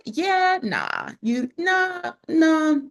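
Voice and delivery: high, loud, sassy "nah" refusal; dismissive brush-off. Retold or imitated. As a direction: wave them off with a sassy nah. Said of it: "So I was like".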